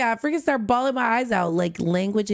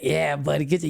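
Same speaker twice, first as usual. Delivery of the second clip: ordinary talk, nothing marked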